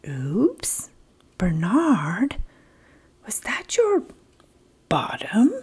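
soft whispered embarrassed bear; a hint of a guilty bear; sheepish bear.

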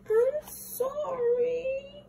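very high, slow crying apology; tearful sorry. Acted. David apologises to Mom, high slow crying. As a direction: drag it out through tears.

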